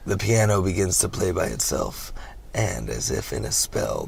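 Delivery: ordinary talk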